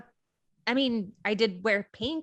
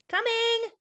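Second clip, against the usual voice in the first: high, raised call from another room; calling back; 13 semitones higher, 4 dB louder than usual. Acted. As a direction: call back that you're on your way. Answering call to dinner.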